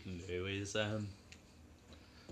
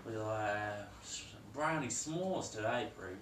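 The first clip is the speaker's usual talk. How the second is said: put-on broad Australian accent, half-joking; a mock Aussie cooking guest; hammed-up Aussie accent.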